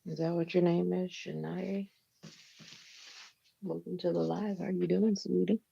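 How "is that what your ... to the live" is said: ordinary delivery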